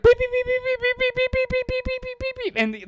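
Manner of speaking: very high, squeaky imitated beeps; mock treadmill beeping. Kid pounding the treadmill speed key, imitated with high beeps. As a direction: chirp the beeps like a machine. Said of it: "he's like".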